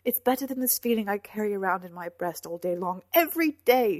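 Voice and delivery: loud, rising to a peak; impassioned outpouring; anguished protest. A narrator's reading. She protests her pain.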